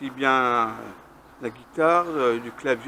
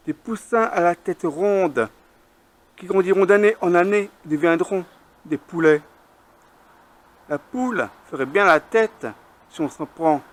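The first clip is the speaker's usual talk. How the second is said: higher, louder measured verse reading; poem recitation; 5 semitones higher, 5 dB louder than usual. Acted. Poet reciting a hen poem at a slam, measured declamation. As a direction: recite it line by line, with pauses and lifted pitch.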